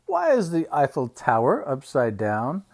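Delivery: usual speaking voice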